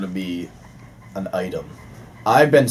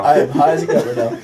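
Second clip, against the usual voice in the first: laughing. Pretend. Repeating the joke laughing.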